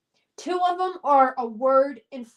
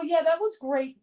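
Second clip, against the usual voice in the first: usual speaking voice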